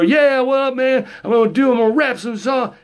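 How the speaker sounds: high, fast put-on rapper voice. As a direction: ham up a cartoon rapper. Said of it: "does [name] actually talk like that".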